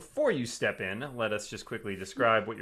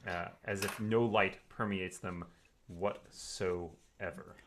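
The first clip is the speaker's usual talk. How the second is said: game master scene setting; 4 semitones lower, 5 dB quieter than usual. A narrator's reading. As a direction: set the eerie scene quietly. GM describes the room.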